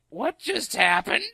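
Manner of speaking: slow, stunned mock confusion; dazed. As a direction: let it hang like you're in shock. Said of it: "blinked at me and went".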